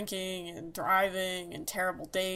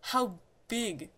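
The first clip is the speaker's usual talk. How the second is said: slow, insistent question; slow deadpan repeat; slower than usual. Retold or imitated. Taunting a freaked-out girl, slow and insistent. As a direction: drag out each word to needle her. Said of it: "he'd be like".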